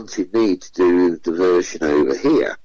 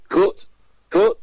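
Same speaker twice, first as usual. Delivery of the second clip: much higher call of cut; producer calling cut; 11 semitones higher than usual. Retold or imitated. Producer halting filming, high call. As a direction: stop the take from the gallery.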